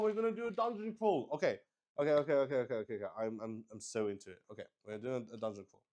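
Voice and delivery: usual speaking voice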